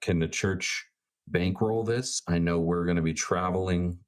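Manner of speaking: ordinary talk, nothing marked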